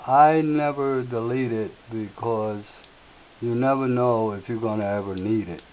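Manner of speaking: ordinary delivery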